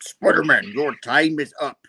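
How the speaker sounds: lightly hammed villain threat; a touch of menacing villain; mock villain Doc Ock. Doc Ock threatening Spider-Man, mock villain voice.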